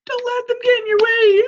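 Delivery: high, tearful voice breaking; choked up. Real, not acted. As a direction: fight back real tears while you speak. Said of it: "I'm gonna get emotional".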